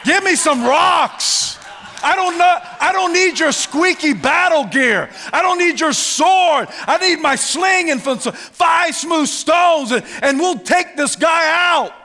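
loud, high shouted boast; David's talk. Acted. Young warrior refusing the battle gear, shouted boast. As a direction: shout it, raring to fight. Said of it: "That's David's talk".

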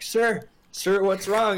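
high, urgent rescuer voice; hammed-up high-pitched concern; urgent concern, acted. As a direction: call out to a stranger in mock alarm. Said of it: "I run up to him like".

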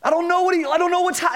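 high, stammering protest; whiny Job. Retold or imitated. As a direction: stammer like a flustered man pleading. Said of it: "He's like".

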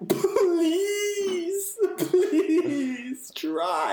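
high, crying whine of a begging driver; mock begging. Frustrated driver mock begging traffic in a high whimper. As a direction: plead in a squeaky fake sob. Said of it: "I'm like".

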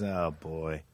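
low, soft aside; understated foreboding. Retold or imitated. Retelling man about to lock the door, quietly ominous. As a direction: drop it to a near mutter.

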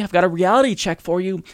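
normal talking voice

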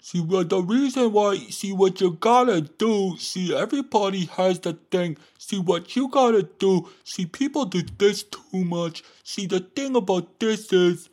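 high, loud mocking preachy voice; Seneca preaching. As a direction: harangue in a hammed-up, high-pitched nagging voice. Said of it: "he was just preaching at you".

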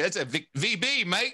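playful Australian twang; Australian accent. Acted. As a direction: correct them in a put-on Australian accent. Acts out Australian correcting beer name.

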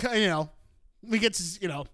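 ordinary talk

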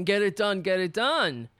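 normal talking voice

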